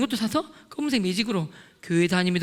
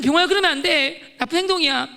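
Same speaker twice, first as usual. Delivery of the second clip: raised, firm telling-off, pretend; mock scolding; 4 semitones higher, 5 dB louder than usual. What the speaker would say instead, mock scolding. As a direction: reprimand him in a higher voice. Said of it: "저 같으면".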